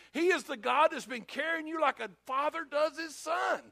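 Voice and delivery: high, impassioned preacher voicing Moses; fervent reassurance. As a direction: press the encouragement home, pitched high. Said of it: "Moses tried to talk to them".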